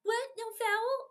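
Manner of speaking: hammed-up player complaining about a missing foul; mock incredulous whine. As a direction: act stunned there was no call. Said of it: "they go".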